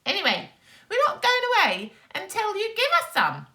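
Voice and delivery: very high, fast, pushy voice; nasty neighbours. Acted. The greedy neighbours refuse to leave, very high and fast. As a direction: insist in a shrill squeak.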